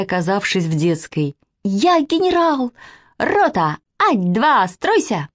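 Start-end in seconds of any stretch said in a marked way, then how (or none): 1.6-5.3 put-on high-pitched childish drill commands; child playing general. A narrator's reading. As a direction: order the troops like a little girl. Girl playing at being general. (детский командирский голос, высоко)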